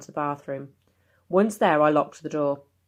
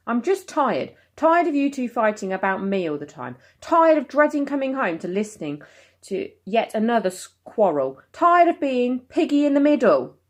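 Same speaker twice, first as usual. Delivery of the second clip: upset outburst; 8 semitones higher, 4 dB louder than usual. Acted.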